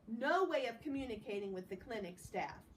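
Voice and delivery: plain reading voice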